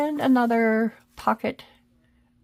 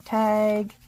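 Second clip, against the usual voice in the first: sing-song; 3 semitones higher than usual. Pretend.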